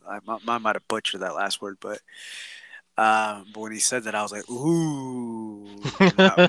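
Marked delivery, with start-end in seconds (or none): 4.5-5.8 long drawn-out "ooh"; drawn-out ooh, retelling his reaction; impressed ooh. Retold or imitated. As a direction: stretch out a reacting "oooh". Reenacts reaction to the line.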